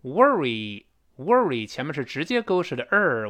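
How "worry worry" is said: "worry" said slowly, "wor" as one r-colored sound; very slow "worry" with the vowel and r said together; American r-colored worry. Shown as a demonstration. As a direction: glide "wor" straight into the r. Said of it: "美式发音的O R直接在一块儿发儿".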